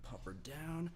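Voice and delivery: whispered aside while stepping away. Real, not acted. Co-host steps away to put the dog down, whispering. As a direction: drop to a breathy whisper before leaving.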